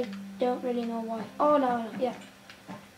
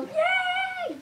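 goofy squeal, much higher; silly cheer. Real, not acted. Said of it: "I sound like a freaking idiot".

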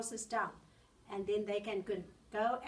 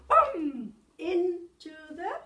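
excited; 7 semitones higher, 11 dB louder, much slower than usual. A narrator's reading. Wolf falls into pot.

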